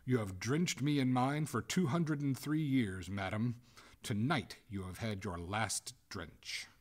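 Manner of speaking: loud, cold pronouncement; emphatic, unrelenting coldness. A narrator's reading. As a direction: pass sentence coldly, slowing at the end. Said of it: "returned Oglethorpe coldly".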